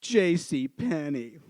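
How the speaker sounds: low, drawn-out name reveal; slow skit punchline. Acted. Skit character answering who they are, slow pompous punchline. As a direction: stretch it out slowly and low. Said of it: "that person goes like this".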